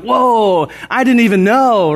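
high, loud burst of surprise; sudden amazed realization; surprised whoa. Retold or imitated. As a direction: gasp it out, caught off guard. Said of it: "And I'm like".

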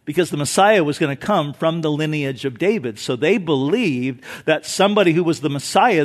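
ordinary delivery

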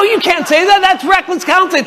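high-pitched, incredulous objection; retold protest. Retold argument with the pastor.

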